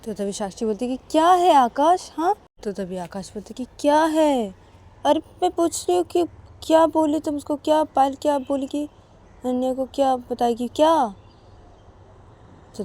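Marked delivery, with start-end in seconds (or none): 1.1-2.3 snappy. Acted. Sakshi snapping at Akash. 3.8-11.1 pressing. Acted. Akash pressing Sakshi.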